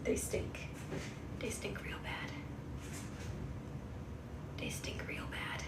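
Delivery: whispered aside; whispering. Real, not acted. Owner whispering so as not to wake the kittens. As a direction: keep it a whisper beside the kittens.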